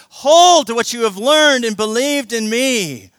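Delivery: loud, high, driving sermon; preaching at full force. Acted. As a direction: preach it like a command.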